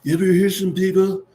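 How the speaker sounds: rising mock Trump voice; imitating Trump. Host retelling Trump's Nevada line, lightly imitated. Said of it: "He said".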